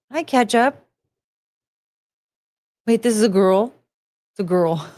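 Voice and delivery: ordinary delivery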